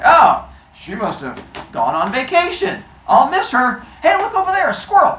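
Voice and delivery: high, loud, over-bright dodge that climbs to a squeal; change the subject loudly and fast; evasive deflection. Retold or imitated. Zeus deflecting about Metis.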